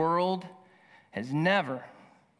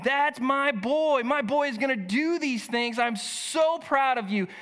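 proud father; 6 semitones higher than usual. Retold or imitated. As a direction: cheer like a father bursting with pride. Zechariah voiced cheering his newborn.

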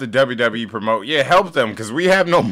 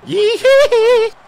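very high, loud squeal; squealed cheer; 8 dB louder than usual. Pretend. Gamer cheering his own throw, high squeal. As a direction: squeal it out gleefully in falsetto.

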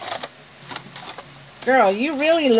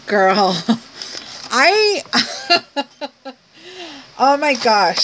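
ordinary talk, nothing marked

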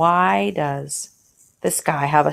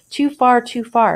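a lightly read-out exclamation, pitched up; mild alarmed cry of too far; exclaiming; 7 semitones higher, 4 dB louder than usual. Acted. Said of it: "exclaimed the good man".

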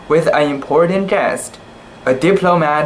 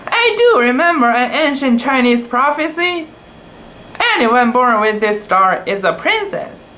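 higher, eager storytelling voice; excited; 8 semitones higher than usual. A narrator's reading. Tai Tai recalling the prophecy, higher and excited. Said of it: "getting excited".

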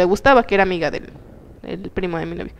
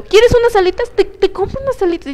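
imitating a pushy, tipsy guy, pitched high; eager drunk suitor; 10 semitones higher than usual. Retelling how a tipsy guy at a bar pushed wings on her, mock eager. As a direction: play him overeager, pressing food on her.

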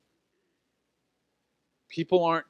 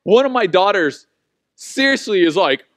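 normal talking voice

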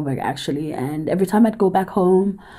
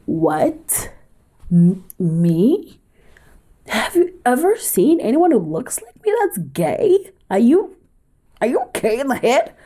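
mock outrage; 7 semitones higher than usual. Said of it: "I denied, I was like".

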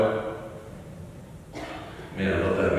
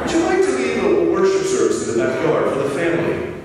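high, hammed-up English accent; lousy English accent; 9 semitones higher than usual. Mother-in-law requesting a family service, high fake English accent. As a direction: badly imitate a British mother-in-law's polite request. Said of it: "that's my lousiest English accent".

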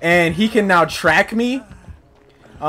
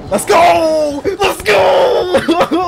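triumphant shout; 11 semitones higher, much slower than usual. Real, not acted. Player cheering a narrow escape, triumphant yell.

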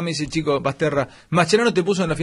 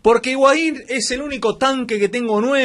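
raised, higher-pitched insistent retort; heated insistence; 6 semitones higher than usual. Real, not acted. Host defending his striker to objecting panelists, heated insistence. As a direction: argue it back, pitch pushed up.

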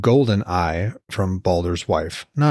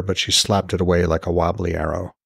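plain reading voice

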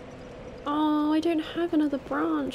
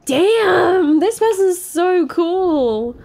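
loud, high, drawn-out admiration; real loud awe, stretched slowly; excited awe; 5 semitones higher, 8 dB louder, slower than usual. Real, not acted. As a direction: gush over how cool he is. Awed by the NPC.

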